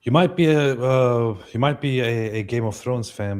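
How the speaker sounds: ordinary talk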